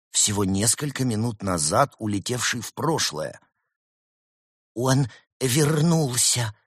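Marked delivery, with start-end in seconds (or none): 4.7-6.5 high, slow astonished exclamation; stretched-out cry of wonder; amazed gasp. A narrator's reading. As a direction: gasp it out, drawing the words out. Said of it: "охнул Евгений".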